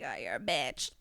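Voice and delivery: low, teasing jab at a friend; low mocking tease at the friend skipping shots; mock taunt. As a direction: sneer it out playfully.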